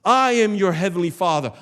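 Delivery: ordinary delivery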